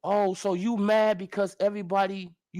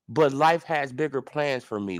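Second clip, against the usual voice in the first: mocking impression of Ugas; 9 semitones lower than usual. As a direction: drag it out in a low, pompous mock-humble tone. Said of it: "let's read it again".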